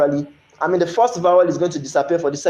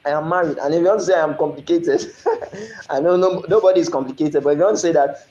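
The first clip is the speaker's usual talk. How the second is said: usual speaking voice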